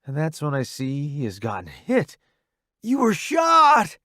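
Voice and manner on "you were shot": high slow shocked yell; alarmed shout. Acted. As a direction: blurt it in alarm. Sees his friend wounded.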